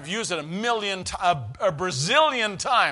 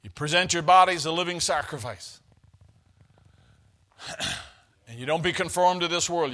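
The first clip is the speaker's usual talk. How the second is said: ordinary talk, nothing marked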